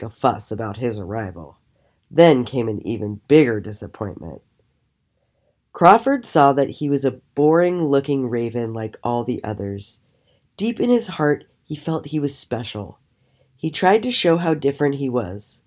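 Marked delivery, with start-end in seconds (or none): none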